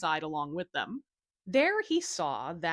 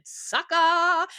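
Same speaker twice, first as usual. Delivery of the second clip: loud, high gloating yell; devil's mocking shout; shouted taunt; 9 semitones higher, 9 dB louder than usual. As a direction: jeer it up at the boy. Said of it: "climbed out of the well and shouted".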